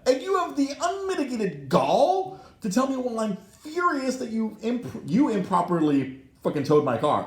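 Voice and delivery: high, loud, indignant tirade; furious rant. Retold or imitated. Retelling his argument with the towing lady, furious rant. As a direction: let outrage climb into a shrieky shout.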